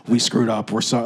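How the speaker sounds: low, soft sheepish apology; apologetic bus. Retold or imitated. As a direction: mumble it like a guilty bus. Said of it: "the bus needs to be the one being like".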